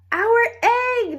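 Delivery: high, loud joyful cry; shouted. A narrator's reading. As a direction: burst out with delight. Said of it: "they shouted".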